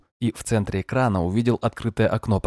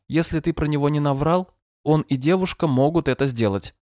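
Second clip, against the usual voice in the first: gentle but monotone synthetic voice, higher; screen reader voice; 6 semitones higher than usual. Acted. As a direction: read it like a calm machine voice. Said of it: "звучал ласково, но монотонно".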